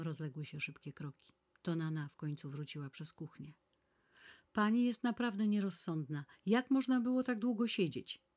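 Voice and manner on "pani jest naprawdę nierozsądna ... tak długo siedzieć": loud, higher scolding. A narrator's reading. Maid scolding her mistress for coming home late, loud reproachful scolding. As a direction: tell her off sharply, voice raised. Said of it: "napominała ją Zoe".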